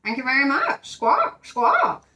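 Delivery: high parrot voice ending in squawks. Acted. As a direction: talk like a cheeky parrot.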